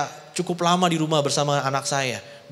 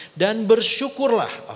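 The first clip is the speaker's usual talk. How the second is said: slow and louder, stretched out; emphatic command; 8 semitones higher, 4 dB louder, much slower than usual. Acted. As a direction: land "bersyukurlah" slowly and loudly. Preacher stresses the command. ("Dan bersyukurlah" diucapkan pelan, keras, ditekankan)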